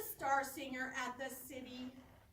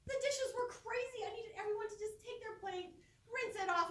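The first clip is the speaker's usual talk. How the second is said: normal talking voice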